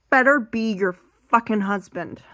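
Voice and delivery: usual speaking voice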